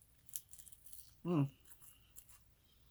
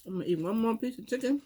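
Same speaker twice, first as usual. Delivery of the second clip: ordinary talk, nothing marked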